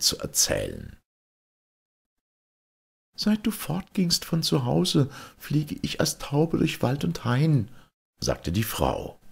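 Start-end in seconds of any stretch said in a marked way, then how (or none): 3.1-7.7 put-on much higher young wife's voice; wife telling her husband how she flew as a dove, voiced high; Fedot's wife. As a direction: lift the voice high and gentle for the wife.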